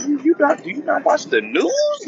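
high, disbelieving comeback; mock outrage, high and incredulous; incredulous retort. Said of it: "I'm like".

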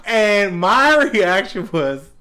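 ordinary talk, nothing marked

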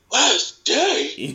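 high-pitched mock shock; acted disbelief. Fired worker to his boss, acted high-pitched disbelief. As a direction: question it in stunned, high-voiced disbelief.

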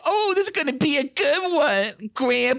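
put-on high, loud gleeful child; excited little boy. Little Sam thrilled to hear grandpa's story, high and loud. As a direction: squeal like an eager kid.

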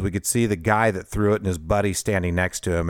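normal talking voice

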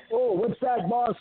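high-pitched eager sidekick; Coco. Retold or imitated. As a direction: squeak it up high, answering the boss. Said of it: "in that high pitch".

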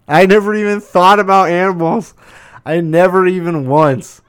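high, loud, incredulous burst; astonished realisation. Real, not acted. Host realising pets go unvaccinated too, loudly astonished. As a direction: pitch it right up, genuinely astonished.

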